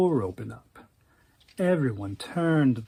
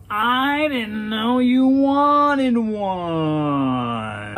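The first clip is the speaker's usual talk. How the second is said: very slow, higher, louder drawn-out moan; moaning whine; 6 semitones higher, 4 dB louder, much slower than usual. Acted. Daryl whining an excuse to the queen, slow drawn-out moan. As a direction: stretch every word into a whiny moan. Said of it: "He moaned".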